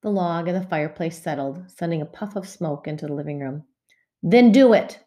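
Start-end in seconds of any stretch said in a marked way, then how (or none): 4.2-5.0 louder, higher, a sharp snap; snapped. Acted. Mother losing patience with Ramona, snapping. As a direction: bite the words off short. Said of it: "snapped Missus Quimby".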